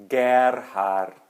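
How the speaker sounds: Gerhard said with a hard G; slow Gerhard, hard G sound; German hard G. Shown as a demonstration. Teacher repeating his name with German hard G. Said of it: "e sim Gerhard".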